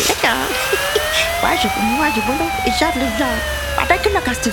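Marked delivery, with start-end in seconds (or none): 0.3-1.4 high nervous laugh. Acted. Mother laughs off the worry.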